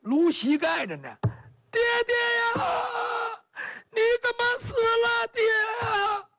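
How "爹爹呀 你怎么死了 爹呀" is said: high, loud sobbing lament; wailing loudly. Acted. Empress mourning over the covered body, loud wailing. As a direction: cry out the grief in full voice. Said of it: "放声大哭".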